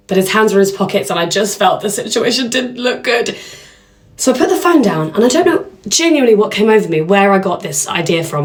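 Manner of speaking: ordinary delivery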